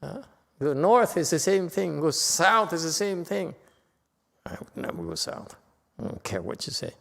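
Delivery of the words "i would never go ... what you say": quiet aside. Real, not acted. Joking aside about going south.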